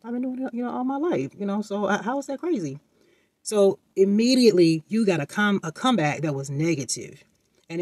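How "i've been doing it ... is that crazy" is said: high, bewildered inner question; puzzled inner voice. Retold or imitated. Child thinking to himself, high and puzzled. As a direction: wonder aloud, confused and hurt. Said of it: "you think to yourself".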